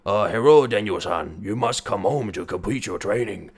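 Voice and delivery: mock Japanese accent, low and deliberate; hammed-up Japanese sensei accent. Shown as a demonstration. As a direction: put on a joking Japanese accent. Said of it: "I will do a Japanese accent".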